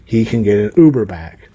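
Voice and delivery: normal talking voice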